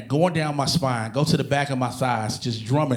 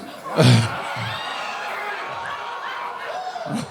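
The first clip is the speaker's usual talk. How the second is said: high strained groan; 4 dB quieter than usual. Retold or imitated. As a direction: let out a strained high whine. Strained noise during stretch.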